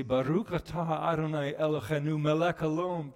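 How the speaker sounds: Hebrew words chanted in prayer; Hebrew blessing. Retold or imitated. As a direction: recite the Hebrew blessing reverently. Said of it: "and say".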